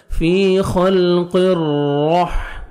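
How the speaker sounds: فی خلق الرح with a full, heavy doubled را; slow tajweed drill. Shown as a demonstration. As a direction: make the را in الرحمن heavy. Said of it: "را کو پر بھی کرنا ہے".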